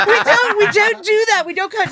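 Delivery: loud and high, laughing through the rule; laughing while playing the prim leader; laughing mock scold. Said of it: "And the guy's like".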